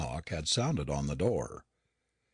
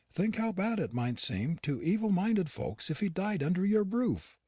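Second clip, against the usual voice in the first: put-on higher, earnest plea from an old servant; pleading housekeeper; 8 semitones higher than usual. A narrator's reading. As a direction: beg him gently but urgently. Nancy pleads for a doctor.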